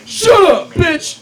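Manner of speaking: high, loud comic outburst; mock angry yell. Comedian snapping back at his brother, mock angry yell. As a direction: yell it as an over-the-top retort.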